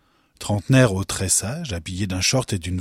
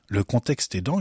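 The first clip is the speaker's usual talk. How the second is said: plain reading voice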